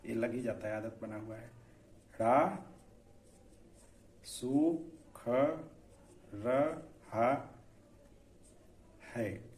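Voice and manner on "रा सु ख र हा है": रा, सु, ख, र, हा spoken slowly one by one; syllable by syllable. Shown as a demonstration. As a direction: spell out रा सु ख र हा slowly, separately. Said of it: "अब देखिए हम नहीं लगाते हैं".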